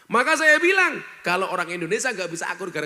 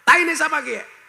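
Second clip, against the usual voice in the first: much higher, louder startled question; ngapak villager asking; 9 semitones higher, 8 dB louder than usual. Retold or imitated. Ngapak man who stepped in dung asks a Sundanese man, loud and startled. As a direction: blurt it out in shock. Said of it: "orang ngapaknya tanya".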